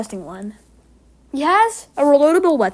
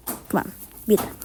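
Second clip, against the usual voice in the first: whisper; 3 semitones lower, 5 dB quieter than usual. Real, not acted. Urging on during play.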